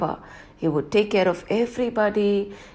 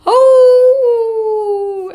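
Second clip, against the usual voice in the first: long high howl; wolf howl; 10 dB louder than usual. Acted.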